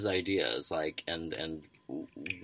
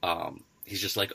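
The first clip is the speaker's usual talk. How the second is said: ordinary delivery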